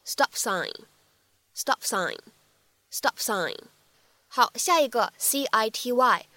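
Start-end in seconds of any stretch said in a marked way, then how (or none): 0.1-3.6 "stop sign" with the P of "stop" unreleased; "stop sign" joined, repeated with pauses; incomplete plosive. Shown as a demonstration. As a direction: hold back the P in "stop" before "sign". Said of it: "放在一起的不完全爆破".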